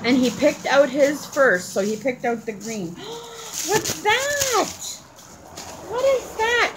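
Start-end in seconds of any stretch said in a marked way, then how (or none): 2.9-3.6 quick, high gasp of surprise; excited gasp. Real, not acted. Mother reacting to the child's find, excited gasp. 3.7-6.7 excited wonder. Real, not acted. Mother asking the child about his find, excited wonder.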